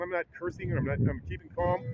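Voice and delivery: normal talking voice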